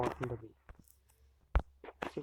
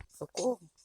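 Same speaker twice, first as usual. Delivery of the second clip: loud frightened cry for help; calling for help; 4 semitones higher, 5 dB louder than usual. Real, not acted. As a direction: yell for help in panic. Witches took his armor.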